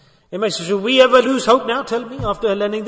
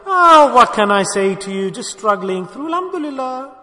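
exaggerated sighing grumble; mock complaining; 2 semitones higher than usual. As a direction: parody someone playing the martyr about their health. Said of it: "We say like this".

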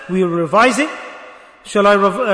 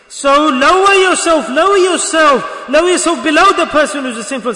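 emphatic exhortation; 6 semitones higher, 6 dB louder than usual. Real, not acted. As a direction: stretch it out and preach it hard. Urges listeners to humble themselves.

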